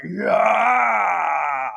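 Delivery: long, drawn-out roar; triumphant yell. Retold or imitated. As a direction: roar it like a player standing over his opponent. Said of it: "Romero just goes like".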